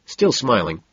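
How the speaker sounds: ordinary delivery, nothing marked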